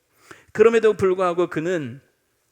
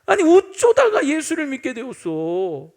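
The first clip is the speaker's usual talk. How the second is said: loud, high imitation of reproachful disbelief; mock dismayed question; 7 semitones higher, 5 dB louder than usual. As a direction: exaggerate the reproach, stretching the question. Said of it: "축하하고 잘했다는 말이 아니라".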